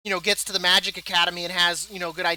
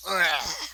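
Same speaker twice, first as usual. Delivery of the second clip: mock groan; 3 semitones lower than usual. Mock gamer lost in game.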